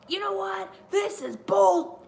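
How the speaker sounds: high, slow mock promo voice; imitating Bret Hart. Retelling Bret Hart's promo, a high mock rant. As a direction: ham up an angry wrestler's promo. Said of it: "He was like".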